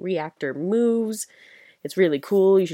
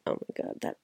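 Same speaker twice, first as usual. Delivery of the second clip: whispered; 11 dB quieter than usual. Real, not acted. Reacting to creepy trailer.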